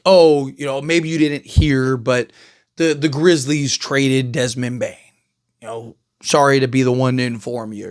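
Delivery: understated mock-innocent dig, voice kept low; sarcastic. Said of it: "be a little sarcastic and be like".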